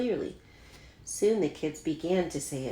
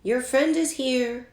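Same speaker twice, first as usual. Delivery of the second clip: calling out; 8 semitones higher, 6 dB louder than usual. A narrator's reading. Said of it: "Mother called up to Yoon-hai".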